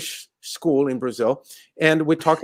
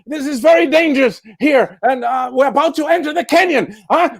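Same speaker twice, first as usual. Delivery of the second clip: wary guard; 6 semitones higher than usual. Acted.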